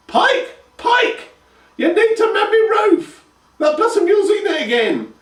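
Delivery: high, loud cackling hag voice; old crone calling. A narrator's reading. Grandma shouting for Pike to mend her roof. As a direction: holler for her grandson like a cranky old woman.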